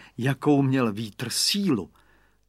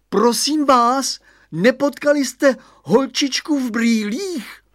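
high, drawn-out call into the wind; 10 semitones higher, 5 dB louder, slower than usual. A narrator's reading. Radovan asks passers-by about the girl, calling out. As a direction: hail passers-by loudly, stretching the words. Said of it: "volal na ně Radovan".

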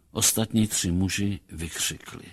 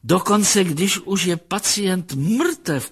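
slow, high-pitched clinical marvelling; drawn-out remark rising into wonder; fascinated psychologist; 8 semitones higher, 3 dB louder, slower than usual. A narrator's reading. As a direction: observe it with acted fascination. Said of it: "poznamenal pan Kse".

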